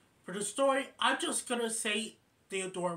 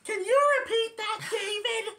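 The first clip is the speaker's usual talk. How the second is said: much higher and louder shouting; screaming; 8 dB louder than usual. Retold or imitated. Miss Miller shouting because she misheard, high loud.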